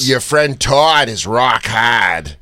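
very slow, broad Boston demo; Boston accent. Shown as a demonstration. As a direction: draw out every Boston vowel, no Rs. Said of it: "So, Boston accent".

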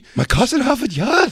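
high-pitched baffled tough guy; hammed-up high Boston-guy voice; mock Wahlberg impression.

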